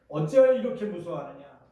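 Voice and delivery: loud stern rebuke; Jesus rebuking, loud and stern. Retold or imitated. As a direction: scold the frightened disciples. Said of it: "이렇게 책망하셨어요".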